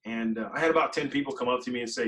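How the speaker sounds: usual speaking voice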